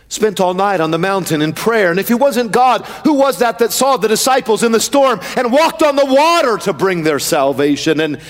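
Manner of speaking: driving, rhythmic pulpit questions; impassioned sermon chant; full-force preaching. Real, not acted. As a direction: preach it as a relentless rolling litany.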